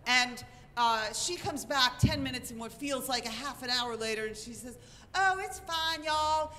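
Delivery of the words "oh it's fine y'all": high-pitched imitation of a nervous Southern friend; Southern reassurance, nervously sung out; nervous Southern accent. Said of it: "talking in that very nervous Southern accent".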